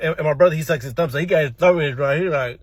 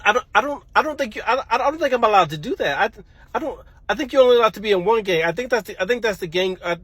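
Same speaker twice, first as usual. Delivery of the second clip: higher-pitched, stumbling excuses; nervous stammering; 5 semitones higher than usual. Retold or imitated. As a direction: stammer your way out of Dad's offer. Nervous excuses to angry dad.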